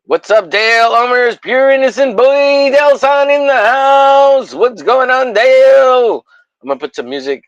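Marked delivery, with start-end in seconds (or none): 0.0-6.2 much higher, louder announcer-style welcome; hype greeting. Acted. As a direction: boom it out like a hype man.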